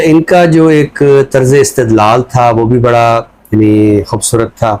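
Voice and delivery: normal talking voice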